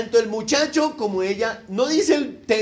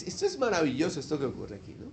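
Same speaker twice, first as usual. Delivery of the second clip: ordinary talk, nothing marked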